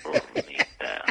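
slow seductive whisper; susurro seductor. Acted. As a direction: whisper it lustfully. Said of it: "No, bonita, no". (susurro lento y seductor)

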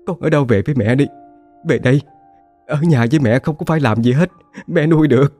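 high, tearful pleading to her daughter; vừa nói vừa khóc. A narrator's reading. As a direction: beg her to come home through tears. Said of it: "vừa nói vừa khóc". (giọng cao, nghẹn ngào van xin con về)

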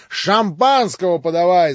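loud, high bellow for champagne; shouted order. Acted. Rakitin, lording it over the house, shouting for champagne. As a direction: shout the demand across the room. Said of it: "закричал Ракитин".

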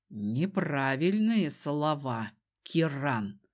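low, slow man's voice; stern Desmond. A narrator's reading. Desmond rejects Kiran's plea, low and stern. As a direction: correct him in a deep, unhurried threat.

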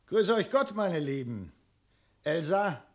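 loud, high hearty hello; big jovial welcome; booming greeting. Acted. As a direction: boom it across the room. Dragon bursts in, greeting.

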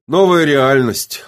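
heavy, emphatic gloom; sullen reply. A narrator's reading. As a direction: answer glumly, drawing the words out. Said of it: "угрюмо ответил Кирилл".

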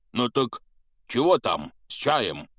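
loud, high eager question. A narrator's reading. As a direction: rub your hands, keen. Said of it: "спросил он, потирая руки". (нетерпеливо, громко)

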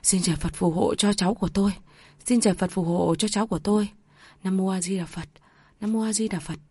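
soft, fast murmured prayer. A narrator's reading. Grandmother praying softly for her grandson. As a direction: pray under your breath for the grandchild. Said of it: "chắp tay cầu khấn".

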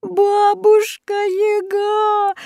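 very high, slow wailing cry; girl crying harder to the witch. A narrator's reading. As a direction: sob the name out. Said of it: "ещё громче заплакала Люська".

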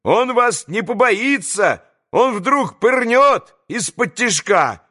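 high, slow, emphatic calling out; warning shout. A narrator's reading. Boys caution Alyosha about a sneak attack, warning shout. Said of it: "кричали ему вслед предупредительным".

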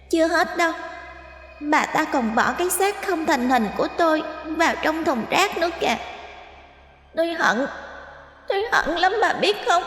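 put-on resentful ghost child. Ghost child accusing its mother, bitter and growing angry. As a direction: spit out the grudge of an abandoned child spirit. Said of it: "Đứa bé càng nói, nó càng tức giận".

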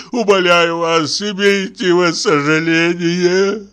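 high, slow whining plea; pleading sick passenger. Acted. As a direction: beg pitifully, slowly. (жалобная мольба больного)

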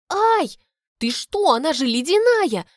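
high, loud yelp of shock; startled high-pitched protest; squealing. A narrator's reading. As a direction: shriek as icy water hits. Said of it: "взвизгиваю я".

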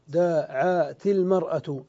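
slow and deliberate, the kasra on the ta linking into المرأة; slow demonstration. Shown as a demonstration. Teacher showing students how the ta takes a kasra, said slowly. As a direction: spell out دعت المرأة slowly so the joining vowel is heard. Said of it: "فكسرنا التاء".

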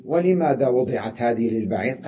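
ordinary talk, nothing marked

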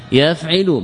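يفعل pronounced yaf'ilu, i following the ayn; kasra on the ayn. Shown as a demonstration. Teacher naming each vowel for students, kasra demonstration. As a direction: put a clear i in the middle of يفعل, yaf'ilu. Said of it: "بكسر العين".

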